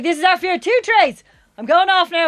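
loud, high-pitched imitation of a partner; mock dramatic goodbye. Partner leaving for months abroad, mock dramatic goodbye. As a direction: declare it theatrically, imitating him.

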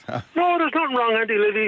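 high reassuring voice; calm her down with a smile; reassuring Brendan. Retold or imitated. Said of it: "He said".

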